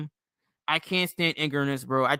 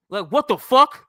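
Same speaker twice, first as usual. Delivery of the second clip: higher and louder, disbelieving; real angry outburst; 6 semitones higher, 6 dB louder than usual. Real, not acted. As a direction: let it burst out in outrage. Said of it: "Shit pisses me the fuck off".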